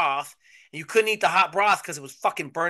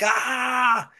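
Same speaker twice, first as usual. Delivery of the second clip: mock angry outburst; 8 semitones higher than usual. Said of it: "he came in the room and was like".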